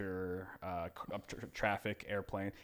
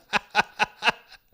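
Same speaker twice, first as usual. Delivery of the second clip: high whispery giggle; 12 semitones higher, 11 dB louder than usual. Retold or imitated. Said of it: "She goes".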